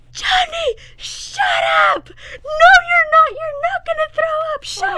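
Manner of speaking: frantic high shrieking at her brother; panicked screaming. Retold or imitated. Girl in the back seat screaming at her carsick brother, frantic shriek. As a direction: shriek through tears, begging him not to. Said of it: "I'm sobbing".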